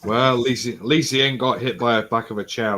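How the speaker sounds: usual speaking voice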